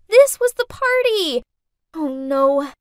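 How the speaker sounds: sudden dread, almost yelling; panicked. Acted. Girl realizes her mom serves at the party, loud panic.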